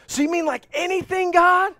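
high, incredulous question; mock disbelief. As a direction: act out a doubtful listener, high. Mock listener questioning God.

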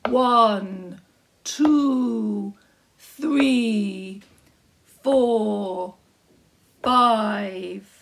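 loud, slow giant counting. Acted. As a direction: count each coin like a big giant. Giant counts his coins.

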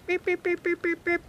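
high, soft mimicked watch beeps; imitated beeping. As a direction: beep like the watch.

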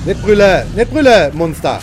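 loud exasperated yell at the monster. Real, not acted. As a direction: shout the beast down as it roars. Yelling back at roaring monster.

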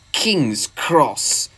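slow, scornful engine voice; scornful snort. A narrator's reading. Visiting engine scoffing at Gordon's station, snorting. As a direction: draw the name out in disdain. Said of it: "snorted the engine".

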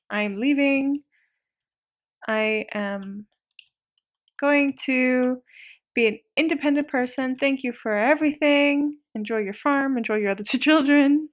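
slow, deliberate mock farewell speech; departing son's farewell. Son thanks dad on leaving home, slow mock farewell. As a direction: play a grown son solemnly announcing his departure. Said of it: "handshake with his father".

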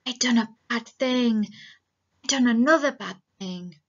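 mild storybook dread, no real whisper; loud-edged anxious confession; a touch of fright, spoken aloud; frightened, fully voiced. A narrator's reading. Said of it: "he whispered in fright".

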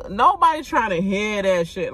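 ordinary talk

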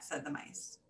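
plain reading voice

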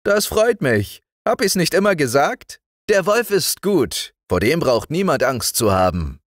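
put-on old doctor greeting the girl, loud and high. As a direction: beam at the child as the kindly doctor.

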